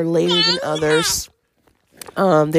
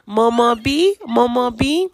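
grandma coaxing; 6 semitones higher than usual. Retold or imitated. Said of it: "My mom was like".

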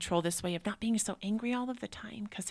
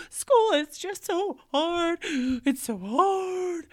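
put-on high, slow, teary child's wail; crying kid; 11 semitones higher, 8 dB louder, much slower than usual. Mother voicing her son crying about school, teary wail. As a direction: sob it out like a little boy.

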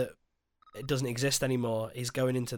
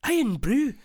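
high, louder mock Scottish accent; 11 semitones higher, 3 dB louder than usual. Mocking the movie's Scottish line, mock Scottish accent. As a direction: ham up a Scottish accent on "Iron Brew". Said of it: "that was too good of a Scottish accent".

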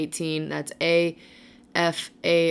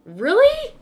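surprised; 9 dB louder than usual. Real, not acted. Shocked she'd shave eyebrows.

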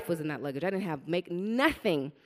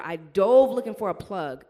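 ordinary delivery

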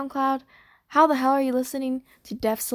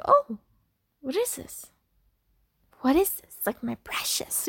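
a light imitation of the Hobbit creature hoarding its precious, sliding into a whisper; half-whispered, mock-greedy creature voice; little Hobbit creature. Vlogger jokingly imitates the Hobbit creature crooning over its precious, lightly whispered. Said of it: "that little thing in The Hobbit".

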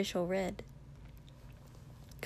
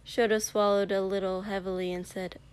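ordinary delivery, nothing marked

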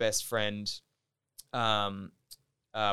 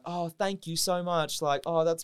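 hammed-up British politeness, pitched up; British accent; 7 semitones higher, 7 dB louder than usual. Thanking the actress who mistook him, in bad British accent. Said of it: "in a British accent".

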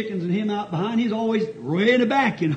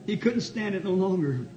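ordinary delivery

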